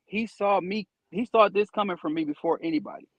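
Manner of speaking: ordinary talk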